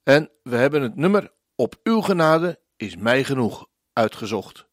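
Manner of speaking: usual speaking voice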